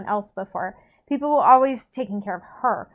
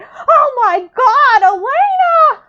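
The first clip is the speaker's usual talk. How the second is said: high, very loud, drawn-out horrified scream; full scream; 12 dB louder, much slower than usual. A narrator's reading. Bonnie sees the wrecked bridge and screams for Elena, full scream. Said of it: "it should be more like".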